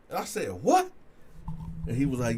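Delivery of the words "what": sharp, high, loud what; shocked. Retold or imitated. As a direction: bark a stunned what. Shocked reaction to claim.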